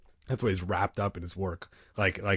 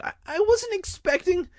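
very high, stammering voice; flustered protest; 3 dB louder than usual. A narrator's reading. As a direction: stammer up high until cut off. Boy protests, cut off by uncle.

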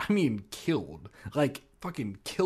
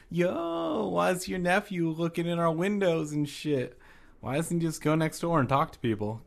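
mock Mister Marling; 6 semitones higher than usual. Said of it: "Mister Marling's like".

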